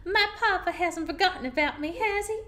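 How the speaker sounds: cat's voice. Acted. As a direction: voice her like a sweet little cat. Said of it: "impression of your cat".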